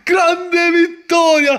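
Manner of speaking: high, slow shouted triumph; victory shout. Real, not acted. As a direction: cheer the win, drawn out. Father celebrates the win.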